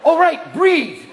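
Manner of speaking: loud, high self-coaching; mock panic. Stoned smoker reminding himself to breathe, loud mock panic.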